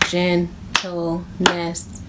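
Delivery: slow syllables; tapped syllables. Shown as a demonstration. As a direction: tap each syllable of "Gentleness". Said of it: "Let's all tap it".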